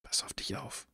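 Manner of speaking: soft worried whisper; whispering a secret. Acted. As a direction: breathe it out as a warning. Whispered farewell warning.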